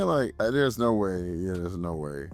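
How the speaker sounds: ordinary delivery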